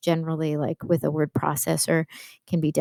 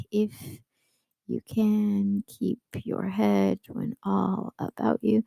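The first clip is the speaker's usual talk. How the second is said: slow, softer iambic beat on "can"; slow sing-song meter; iambic reading; 3 semitones higher, 4 dB quieter, slower than usual. Shown as a demonstration. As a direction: read it slowly with a da-DUM pulse. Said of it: "I'll kind of show you what I mean".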